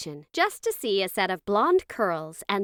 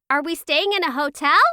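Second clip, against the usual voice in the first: high, louder eager question; excited child; 7 semitones higher, 6 dB louder than usual. A narrator's reading. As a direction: ask like a thrilled kid, rising at the end. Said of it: "as they got excited".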